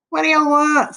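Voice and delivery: put-on high, loud voice of a woken boy; irritated boy. A narrator's reading. Joe woken by Alan's late call, irritated. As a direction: demand it crossly, pitched well up.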